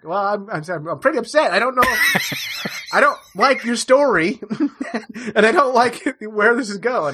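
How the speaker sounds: high, laughing protest; amused mock distress pitched high; mock upset. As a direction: whine that you don't like this story. Telling old man he's upset.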